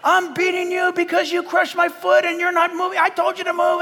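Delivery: high, exasperated comic tirade; hammed-up prophet losing patience; mock angry rant. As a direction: scold the donkey in a high pitch. Prophet quarrelling with his donkey.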